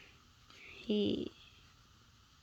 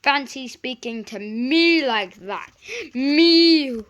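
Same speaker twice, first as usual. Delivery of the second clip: louder huffy grumble; letting off steam; 3 semitones higher, 10 dB louder than usual. A narrator's reading. Gordon grumbling about Henry, louder and huffy. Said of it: "He went on letting off steam".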